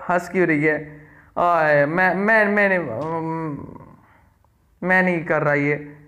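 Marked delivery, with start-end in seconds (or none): none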